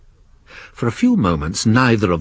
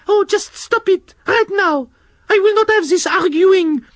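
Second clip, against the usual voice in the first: very high, prim scolding snail voice; Shelley the snail scolding the worm and slug, very high prim voice; French snail Shelley. Acted. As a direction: tell off two squabbling children.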